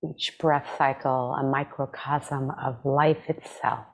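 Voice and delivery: calm, measured meditation voice with long pauses; guided meditation. Acted. Meditation teacher leading listeners through breath and body scan, calm guided meditation. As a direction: guide them gently, leaving space after each phrase.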